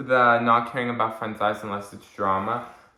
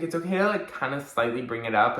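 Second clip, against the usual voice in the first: usual speaking voice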